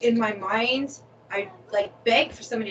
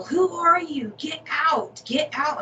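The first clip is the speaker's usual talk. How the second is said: high mock yell at an intruder; half-acted panicked yell; retold scream; 7 semitones higher, slower than usual. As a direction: shout it like you just woke up scared. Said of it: "I'm screaming from the top of my lungs".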